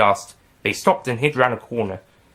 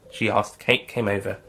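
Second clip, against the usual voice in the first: ordinary delivery, nothing marked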